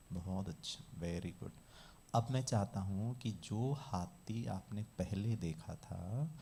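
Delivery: low, soft, measured instruction; guided visualization. Shown as a demonstration. Teacher guiding a class to picture an elephant, calm visualization voice. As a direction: guide listeners gently through each picture.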